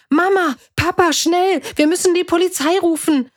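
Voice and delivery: high, breaking-news urgency; urgent calling. A narrator's reading. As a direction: burst in calling for your parents. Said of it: "riss die Tür auf und rief".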